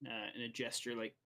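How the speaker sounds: ordinary delivery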